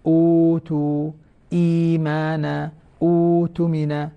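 slow chanted examples, vowels drawn out; tajweed recitation. Shown as a demonstration. Teacher reciting examples of substitute elongation, slow chant. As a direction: recite each word slowly, holding the long vowel. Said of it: "هذه مدود بدل".

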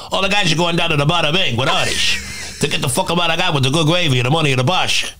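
slushy, ish-laden mobster talk; Tony Soprano impression. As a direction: rattle it off with slurred S sounds. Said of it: "I started just practicing the ish".

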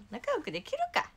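sharp high comeback; high retort. Real, not acted. 言い返すツッコミ. (高い声でツッコむ)

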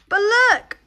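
bright, loud "look!" to show off the trees; a sudden, high, loud call for attention; excited exclaim. Real, not acted. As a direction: burst out with delight.

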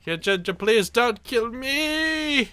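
high, slow stuttering beg for mercy; stammered high plea not to be killed; stammering plea. Real, not acted. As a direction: plead in comic terror. Begging while fleeing blast.

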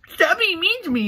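high, whiny mock of Dream complaining. Mocking Dream's Twitter whining in a high whiny voice. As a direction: whine it like a hurt kid.

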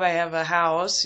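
ordinary talk

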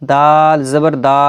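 final দা stretched long; wrongly drawn-out ending. Shown as a demonstration. Teacher shows how it was not read, drawn-out final দা. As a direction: drag the last দা out instead of cutting it. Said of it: "এরকম কিন্তু পড়িনি".

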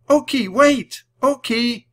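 high, very loud calling; urgent shout. A narrator's reading. Annie sees the puppy dash away, shouting. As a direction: shout after a bolting dog. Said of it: "shouted Annie".

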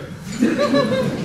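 a high, bright cackle of amusement; high amused laugh. Acted. Wife laughs at his polite after-you, amused.

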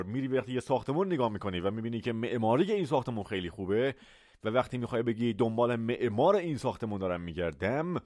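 usual speaking voice

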